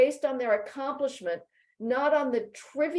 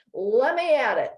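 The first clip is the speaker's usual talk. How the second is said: louder, rising, hammed-up eagerness; eager mock quote; 5 dB louder than usual. Mocking men on campus, louder hammed-up eagerness. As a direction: play a guy who can't wait to get at it. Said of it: "and they'll say".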